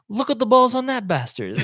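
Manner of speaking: high, loud imitated exclamation; retold outburst of loud admiration; amazed exclamation. Imitating man's reaction to bear.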